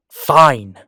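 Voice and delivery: loud, exasperated concession; exasperated outburst. Acted. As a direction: burst out loudly, fed up with arguing. Snaps while giving in to listener.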